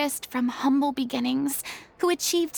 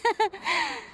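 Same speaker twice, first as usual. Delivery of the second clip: nervous laugh; 6 semitones higher than usual. Real, not acted.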